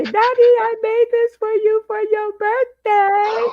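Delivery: a hint of a sweet kid offering a gift; little child voice.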